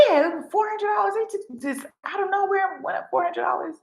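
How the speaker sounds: high, fast complaining neighbor; imitating the upset neighbor; neighbor voiced high. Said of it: "She was like".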